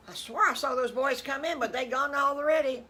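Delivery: high, loud mama pig voice; storyteller's loud mama character; puzzled mama pig. Acted. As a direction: call it out high and baffled. Mama Piggy calls out, puzzled.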